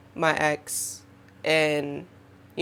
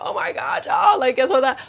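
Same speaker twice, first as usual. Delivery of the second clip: high excited gush; gushing over big news; 6 semitones higher than usual. Retold or imitated. Telling her followers she gets excited, high excited gush. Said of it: "when I'm excited about something".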